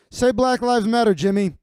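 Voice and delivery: hammed-up bossy host demanding he say it; host barking the demand at Jimmy; loud mock demand. Acted demand in imagined scene.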